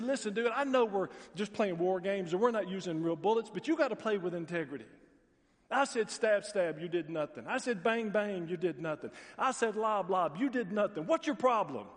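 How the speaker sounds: angry soldier venting at a tank; mad soldier. Retold or imitated. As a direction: tell him off for cheating. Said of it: "That soldier was so mad".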